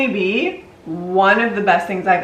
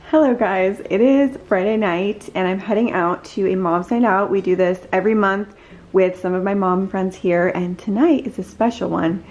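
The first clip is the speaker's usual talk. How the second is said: ordinary delivery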